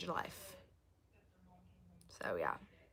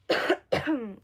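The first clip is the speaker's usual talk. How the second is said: cough; 4 semitones higher, 6 dB louder than usual. Real, not acted. Coughing while losing voice.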